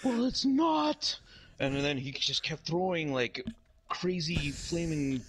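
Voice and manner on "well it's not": high, hammed-up villain threat; Ronald McDonald. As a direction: declare doom as a manic clown. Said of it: "It's like".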